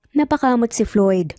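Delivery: ordinary delivery, nothing marked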